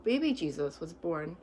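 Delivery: plain reading voice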